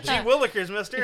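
put-on wholesome 1950s kid. Goofy old-timey exclamations.

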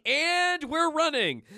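high, loud, drawn-out announcement; comic fleeing cry. Acted. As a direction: sing out a hasty retreat. Deadpool fleeing.

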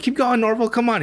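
high-pitched, eager egging-on; playful imitation, pitched well up; amused urging. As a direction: imitate her begging him to continue. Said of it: "Velma's laughing".

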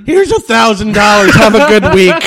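laughing boss impression; mock boss. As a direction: hand it over like a smug boss. Mocking boss handing pay.

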